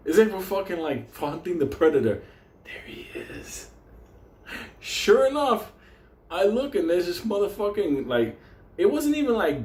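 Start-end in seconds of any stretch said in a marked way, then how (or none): 2.6-3.7 hushed unvoiced whisper. Whispering to his friend while stalking the coyote. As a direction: whisper so the prey won't hear.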